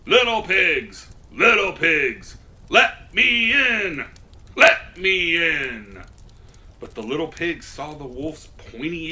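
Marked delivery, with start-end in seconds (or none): 0.1-6.0 loud, slow wolf calling at the door; big bad wolf. A narrator's reading. Wolf calling loudly to the little pig. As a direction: boom it out like a hungry wolf at the door. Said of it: "knocked on the door and said".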